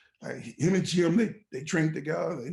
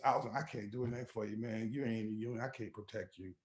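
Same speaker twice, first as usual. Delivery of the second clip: low, soft, fading apology; hushed, powerless let-down; quiet resigned refusal; 5 semitones lower, 10 dB quieter, faster than usual. Retold or imitated. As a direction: imitate a steward giving up, very softly. Steward privately admits he cannot help.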